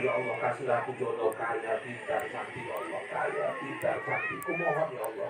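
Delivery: soft, low mock prayer, begging; the bachelor begging God softly for a rich wife; pleading prayer. Soft pleading mock prayer. Single man's prayer voiced.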